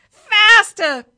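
high, very loud bird scream; screaming parrot. A narrator's reading. Parrot in the driver's ear, screaming. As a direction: scream it right into his ear. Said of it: "it screamed in his ear".

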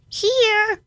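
very high, squawky call; raspy reply. Acted. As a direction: squawk it like a parrot. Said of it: "comes the raspy reply".

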